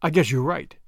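understated solemn agreement. A narrator's reading. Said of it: "Julius replied solemnly".

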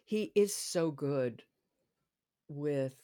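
ordinary talk

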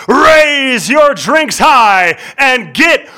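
booming, high ring-announcer shout with rolled R's; full-force boxing announcer, drawn-out names. Shown as a demonstration. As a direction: stretch out key words like a big fight intro. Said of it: "you really turned on the voice".

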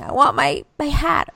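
hammed-up little boy wanting something; whiny child. Son insisting to mom about his hat, mock whine. Voicing her upset son.

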